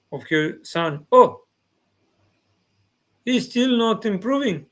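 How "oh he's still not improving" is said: high, loud dismayed cry; mother's dismay. Retold or imitated. Mother checks her son's notepad, loud dismayed exclamation. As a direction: exclaim like a mother who finds no progress. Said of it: "The mother open the notepad".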